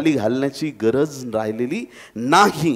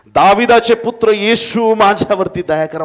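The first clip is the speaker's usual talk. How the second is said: high, urgent shout for mercy; loud crying out; 9 semitones higher, 9 dB louder than usual. Acted. Blind Bartimaeus keeps calling to Jesus for mercy, shouting. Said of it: "ओरडत राहिला".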